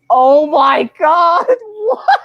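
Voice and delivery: high, loud, slow cry of laughter; laughing shriek of disbelief. Retold or imitated. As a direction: gasp it out through laughter. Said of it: "I was like".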